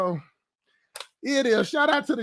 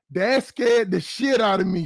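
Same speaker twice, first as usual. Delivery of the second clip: ordinary talk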